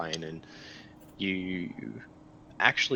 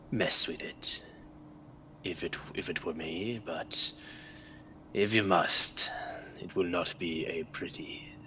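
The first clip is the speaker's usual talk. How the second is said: slow, half-whispered demonic voice; 2 semitones lower, slower than usual. Acted. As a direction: breathe it out slowly like a demon from beyond. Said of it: "this weird demonic voice".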